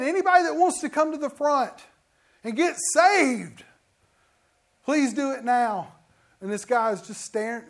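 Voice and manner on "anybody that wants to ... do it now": pleading altar call. Retold or imitated. Preacher repeats altar call louder.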